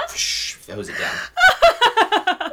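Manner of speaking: high, drawn-out words through laughter; cracking up mid-joke; laughing squeak. Real, not acted. Joke punchline while laughing.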